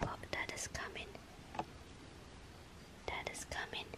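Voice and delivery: hushed whisper so the pets don't notice. Real, not acted. Whispering to viewers while the dog listens. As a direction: whisper softly while watching the animals. Quietly watching pets, not to disturb.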